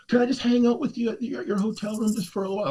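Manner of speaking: high, quick imitated request; pleading woman.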